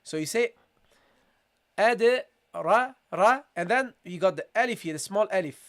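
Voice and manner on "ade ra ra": ra said full and dark; heavy ra. Shown as a demonstration. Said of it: "which should be pronounced heavily".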